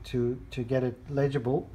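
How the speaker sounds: ordinary delivery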